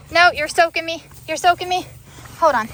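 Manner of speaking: loud protest. Real, not acted. Owner to wet dog that is soaking them, loud protest. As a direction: push the dog off with a loud complaint.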